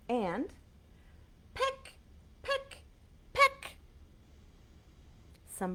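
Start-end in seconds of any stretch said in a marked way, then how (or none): 1.5-3.8 high, sharp little pecks; high pecking. Acted. Showing kids a bird pecking, high and sharp. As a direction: peck each word like a beak.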